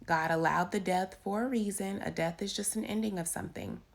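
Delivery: normal talking voice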